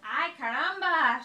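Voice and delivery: loud excited hen cry; loud cry of delight; cried out. A narrator's reading. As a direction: throw it out like a startled hen. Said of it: "she cried".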